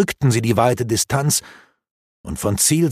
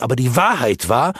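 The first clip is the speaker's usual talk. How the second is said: ordinary delivery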